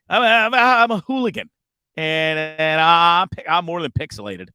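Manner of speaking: loud joking brag; hyped boast. Real, not acted. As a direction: crow it like a cheeky troublemaker.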